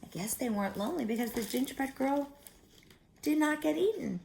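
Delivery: plain reading voice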